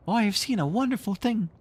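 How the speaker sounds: put-on high, excited boy's voice; a lost boy gasping with wonder; Nibs crying out. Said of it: "he cried".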